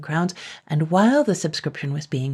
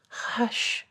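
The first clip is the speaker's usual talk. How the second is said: hushing; 7 semitones higher, 6 dB quieter than usual. Acted. John hushes his wife.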